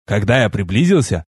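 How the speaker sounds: ordinary delivery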